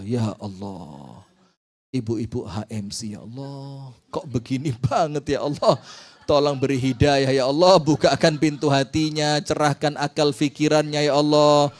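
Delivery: pleading prayer. Shown as a demonstration. Preacher demonstrating a frustrated prayer, pleading. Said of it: "langsung seru sama Allah".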